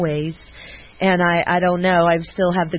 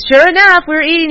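normal talking voice

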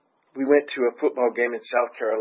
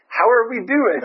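friend asks brightly, higher and louder; friend's cheerful question; 10 semitones higher, 7 dB louder than usual. Retold or imitated. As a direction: pipe up eagerly. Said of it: "And Daryl goes".

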